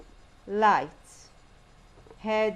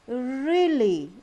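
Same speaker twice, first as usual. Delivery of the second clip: REALLY drawn out loud with a swooping pitch; very slow, exaggerated melody on "really"; intonation demo; 2 semitones higher, 6 dB louder than usual. Shown as a demonstration. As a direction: stretch "really" and bend the pitch through it. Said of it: "down and then up".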